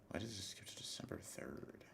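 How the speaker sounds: breathy whisper to himself. Real, not acted. Streamer checking dates, whispering to himself. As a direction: whisper it under his breath, puzzled. Muttering to himself about a date.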